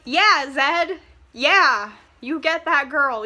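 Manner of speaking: loud and high, cheering Zed on. Real, not acted. Vlogger cheers on Zed over hated Hardin, loud and high. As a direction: whoop for the villain like a fan.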